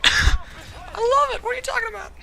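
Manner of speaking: squealing through laughter; real giggling protest; high laughing voice. Real, not acted. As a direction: say it while cracking up, way up high. Laughing defence of his crush.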